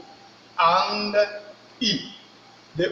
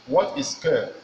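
normal talking voice

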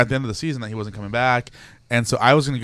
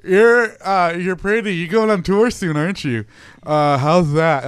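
higher, louder mock fumbling interviewer; hammed-up halting small talk, pitched up; awkward starstruck interviewer; 6 semitones higher, 5 dB louder, slower than usual. Re-enacting a botched celebrity interview, mock-awkward.

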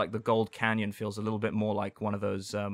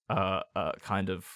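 ordinary talk, nothing marked

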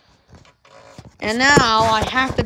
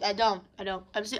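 ordinary talk, nothing marked

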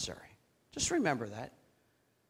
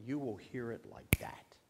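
whisper; 6 semitones lower, 11 dB quieter than usual. Shown as a demonstration. God's quiet voice in prayer.

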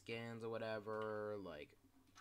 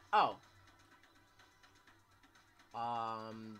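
usual speaking voice

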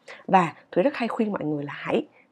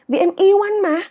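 high, loud "yêu", falling after; stress on YÊU; 11 semitones higher, 6 dB louder than usual. Shown as a demonstration. Teacher insisting love is the reason, stress on YÊU. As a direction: protest with "yêu" pitched right up. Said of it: "nhấn mạnh vào cái hành động là mình yêu".

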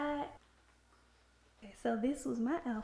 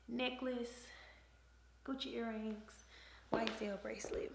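whisper; 10 dB quieter than usual. Real, not acted.

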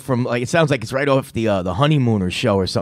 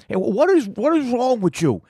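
much higher-pitched mock freak-out voice; imitating a guy flipping out; 13 semitones higher than usual. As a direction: quote the exasperated caller, pitched way up. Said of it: "when he's flipping out".

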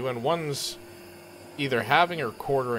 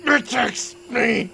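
pained hero voice; 14 semitones higher, 13 dB louder, much slower than usual. Acted. Character voice mid-fall.

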